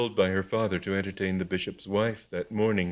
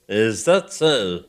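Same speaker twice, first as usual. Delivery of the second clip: slow sneer; 7 dB louder, much slower than usual. Acted.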